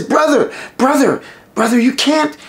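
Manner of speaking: begging brother calling out to the king; urgent pleading. Acted. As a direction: call out to stop your brother.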